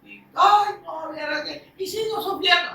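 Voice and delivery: agudo, lloriqueando en burla; quejido lastimero. Retold or imitated. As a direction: imita al que se compadece de sí mismo. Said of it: "de conmiseración". (burla lastimera de quien se autocompadece)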